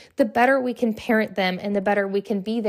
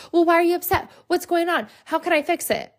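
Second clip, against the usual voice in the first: higher, brisk fussy questioning; mock fixer parent; 8 semitones higher than usual. Mom quizzing her upset daughter, higher fussy questions. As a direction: fire off the questions like an eager fixer. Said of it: "I'm not gonna be like".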